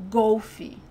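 Golfe with a closed O, ending in ee. Shown as a demonstration. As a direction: say Golfe as "GOH-fee". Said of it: "close the sound of the O".

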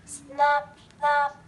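ordinary delivery, nothing marked

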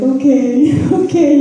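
high, loud okay breaking into laughter; laughing, overwhelmed agreement; acted startled okay. Acting reaction to sudden building news.

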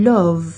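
"Love" ending on a buzzing V; English love. Shown as a demonstration. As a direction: say "Love" as LUV, not lerp. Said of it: "บ่แม่น love I love you จังซี้เด้".

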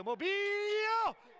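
sudden high shout of a chance; shouting commentary. Real, not acted. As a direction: yell it as the ball flies. Near goal, commentator cries out.

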